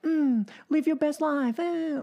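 high, sing-song parody of motivational gushing; mock a sugary self-help slogan; mock preachy. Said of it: "preachy and blah, blah, blah".

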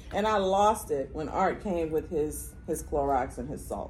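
normal talking voice

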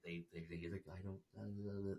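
soft, low imitation of mumbling; under their breath. They get all under their breath.

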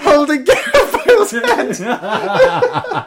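high, slow, squeaking through laughter; can barely get the words out for laughing. Real, not acted. Teasing his friend about the mascot walk, laughing hard.

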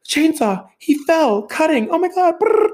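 frantic shriek. Retold or imitated. Mom blurting out the accident, imitated as a high panicked shriek. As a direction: gasp out fragments in sheer panic. Mom screaming what she's seen.

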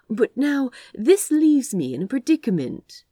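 high, loud, hammed-up musing; theatrical. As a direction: play it grandly, over the top. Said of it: "more theatrically than the situation called for".

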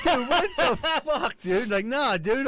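high, loud, drawn-out disbelief; exasperated protest. Retold or imitated. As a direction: protest loudly, voice shooting way up. Exasperated retelling of a phone argument.

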